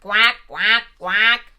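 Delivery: loud quacking duck voice; a duck greeting each guest at the door with loud quacks. Acted. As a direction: quack it out brightly like a little duck. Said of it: "The chickens arrived first".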